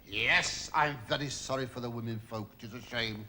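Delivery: ordinary delivery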